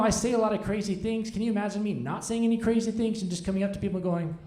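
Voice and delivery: ordinary talk, nothing marked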